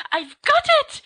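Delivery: high, eager exclamation; excited. Acted. Sirius announcing his idea to friends, high and eager. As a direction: announce it triumphantly.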